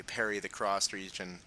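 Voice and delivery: ordinary delivery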